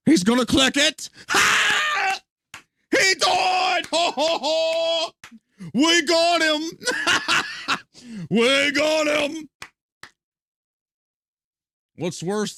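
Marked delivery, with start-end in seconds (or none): 0.1-9.5 imitating developers whooping, high and loud; gleeful mock cheer. Developers cheer as the streamer dies, loud mock triumph. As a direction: shout in triumph like a gloating dev, higher and louder.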